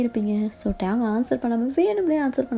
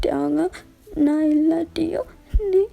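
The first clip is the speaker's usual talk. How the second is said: high, slow, crying voice; crying farewell; 6 semitones higher, slower than usual. Acted. Dying girlfriend tells boyfriend to be happy, crying. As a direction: say goodbye through tears.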